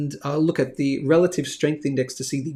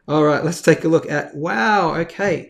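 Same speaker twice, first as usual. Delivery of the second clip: normal talking voice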